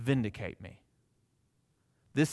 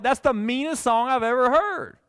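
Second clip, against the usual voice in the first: much higher and louder, aghast; mock shocked outrage; 10 semitones higher, 6 dB louder than usual. Pastor recalling his reaction to the song, mock aghast. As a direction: recoil at the cruelty of it. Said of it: "And I was like".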